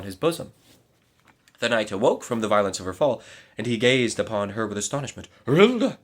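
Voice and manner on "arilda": high, loud astonished call; astonished exclamation. A narrator's reading. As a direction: call her name in shock. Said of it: "he exclaimed".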